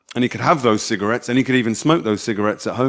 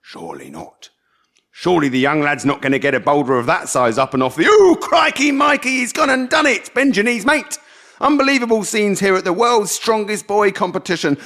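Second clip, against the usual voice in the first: put-on stepdad's jokey commentary on a boy lifting stones; mock sports commentary; 7 semitones higher than usual. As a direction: call it like a strongman event on telly. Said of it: "Ian did a commentary".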